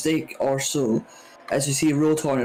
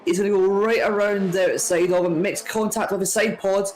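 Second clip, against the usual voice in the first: higher, excited live play-by-play; motorsport commentator; 6 semitones higher than usual. Real, not acted. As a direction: call the overtake as it happens.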